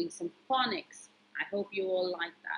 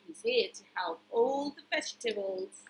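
ordinary delivery

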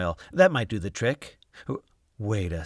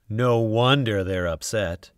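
usual speaking voice

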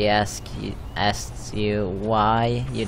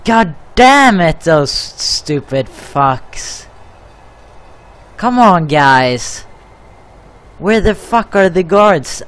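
screaming in real rage at the game; blowing up at useless guards, shouting; furious shout; 7 semitones higher, 8 dB louder than usual. Real, not acted. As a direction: yell it out, fed up and furious. Prisoners escaping, raging at guards.

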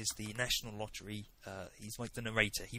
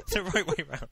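laughing through the words; giggling while correcting; 11 dB louder than usual. Real, not acted.

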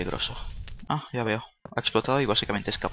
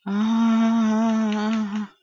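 high, sustained loud "aaah"; long scream; 10 semitones higher, 5 dB louder than usual. Pretend. Gamer stuck in a glitched game, long high scream. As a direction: hold one long high cry.

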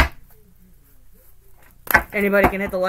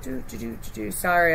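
ordinary delivery

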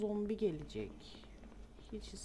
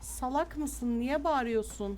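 exasperated; 5 semitones higher, 8 dB louder, slower than usual. Real, not acted. Scolds her game character.

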